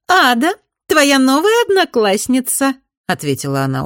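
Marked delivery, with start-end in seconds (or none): 0.1-2.8 high, loud, smirking voice; smirking girl. A narrator's reading. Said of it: "ухмыляясь".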